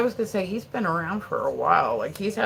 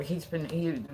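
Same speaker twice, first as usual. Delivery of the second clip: ordinary talk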